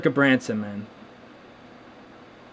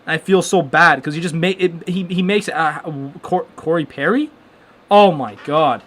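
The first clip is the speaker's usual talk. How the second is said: ordinary talk, nothing marked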